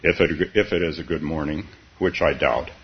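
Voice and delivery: low, doleful donkey voice; gloomy Eeyore. Acted. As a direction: sigh it out like mopey Eeyore. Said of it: "said Eeyore gloomily".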